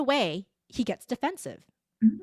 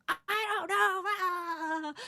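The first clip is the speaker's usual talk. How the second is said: put-on childlike reply; 11 semitones higher, much slower than usual. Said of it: "I'm like".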